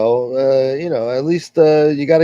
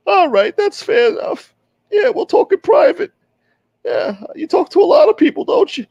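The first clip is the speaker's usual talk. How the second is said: much higher comic voice, cocky and accusing; rival character voice. Acted. Rival bursting in to call the tough guy a liar, much higher voice. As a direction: squeeze it up high and brag. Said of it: "here he is right now".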